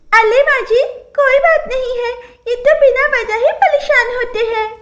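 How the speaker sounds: put-on high giggly baby talk; lisping childish voice. Bride answering mother-in-law in high lisping baby talk. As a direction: lisp brightly with a laugh. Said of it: "पायल तुरंत ही बोल पड़ी".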